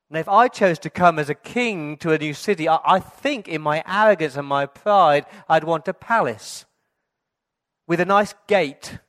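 loud, high, driving sermon; preaching at full force. Acted. Pastor preaches to the congregation, loud and fervent. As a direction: preach it with rising fervor.

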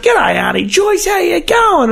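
high, put-on caller voice; prank call voice. Prank caller greeting Auntie Joyce, high put-on voice. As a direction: fake a relative on the phone.

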